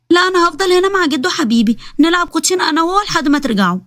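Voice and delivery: put-on high childlike voice, sweet and pleading; little girl voice. Little girl telling mom she'll stay with grandpa, high childlike voice. Little daughter Basmala speaks.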